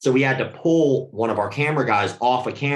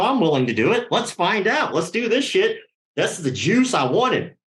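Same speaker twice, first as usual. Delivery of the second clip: pitched up high, eager to take the hit; 7 semitones higher than usual. Retold or imitated. Volunteering to be knocked out in front of the class, pitched-up eager. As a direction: jump at the chance, all in.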